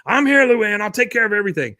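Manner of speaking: high, loud heroic announcement; mock-heroic woman calling out; Peggy to the rescue. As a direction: burst in high and loud to take charge.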